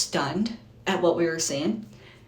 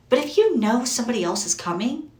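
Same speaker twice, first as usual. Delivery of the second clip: ordinary delivery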